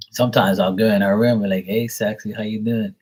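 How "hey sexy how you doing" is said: normal talking voice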